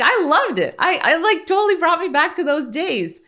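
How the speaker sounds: mock a thrilled teenager recalling good memories; son's delight. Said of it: "He was like".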